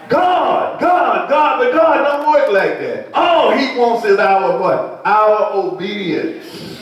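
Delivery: high, ringing shout of God, slowing on obedience; shouted preaching. Acted. Preacher to his congregation on obedience, ringing shout. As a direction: cry out the name, then draw it out.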